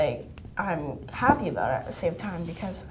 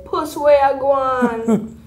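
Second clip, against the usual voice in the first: slow, louder, higher call in Jamaican Patois; Jamaican Patois to the cat; 7 semitones higher, 11 dB louder, much slower than usual. Retold or imitated. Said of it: "I say".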